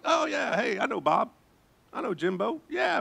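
high, breezy name-dropping; casual acquaintance. Acted. Preacher acting out merely knowing someone, mock casual.

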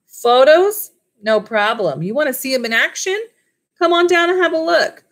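eager salesman. A narrator's reading. Mac eagerly selling on phone.

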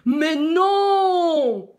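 long, high, loud lion roar; roared protest. A narrator's reading. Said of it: "rugit le lion". (rugissement indigné du lion)

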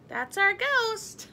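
high, slow, drawn-out exclamation; playful repeat. A narrator's reading. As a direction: call it out merrily, stretching the words. Narrator repeats the Scotts' line to Midge.

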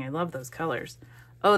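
ordinary delivery

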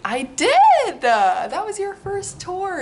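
high, loud, excited exclaim; thrilled shout. Real, not acted. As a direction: burst out with joy. Excited answer.

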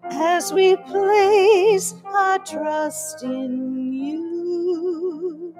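ordinary talk, nothing marked

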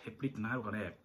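a soft musing repeat of the riddle. Shown as a demonstration. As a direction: say it again to yourself, softly. Repeats the quiz question musingly.

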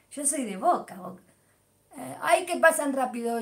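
ordinary delivery

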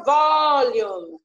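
"volume" with a long, loud VOL; stress on first syllable. Shown as a demonstration. Teacher confirming which syllable is tonic, long stress opening "volume". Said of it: "A primeira".

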